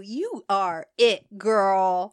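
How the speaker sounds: drawn-out diva compliment; sassy compliment. Retold or imitated. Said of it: "she was like".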